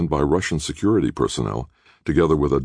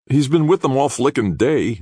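higher, louder irritation; exasperated outburst; 12 semitones higher, 5 dB louder than usual. A narrator's reading. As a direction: vent the frustration. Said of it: "Nick said".

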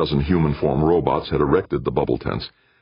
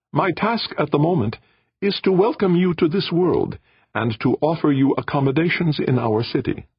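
polite alien voice; 14 semitones higher than usual. Acted. Audrey welcomes the humans.

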